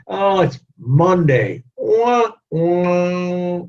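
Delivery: slow mock whine rising into a high, put-on wah wah; mock whining. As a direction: mimic a slug employee whining about Monday. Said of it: "they're always whining".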